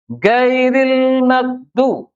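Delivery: "Gairil magdu" said very slowly and high, with a hard g sound instead of ghain; mock wrong G. Shown as a demonstration. Teacher mocks the g-for-ghain mistake, slow, high, hard g. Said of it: "Itu G".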